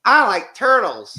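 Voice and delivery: put-on high, loud, slow kid voice; slow goofy child voice; imitating the meme kid. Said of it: "that one kid from years ago going".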